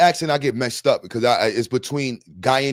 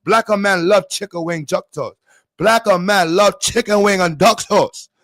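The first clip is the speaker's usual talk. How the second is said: more deliberate put-on Chinese accent; raised, measured Chinese-accent impression; mock Chinese accent; 5 semitones higher, 4 dB louder than usual. Streamer retrying after going too fast, careful mock Chinese accent. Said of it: "See, that was too fast".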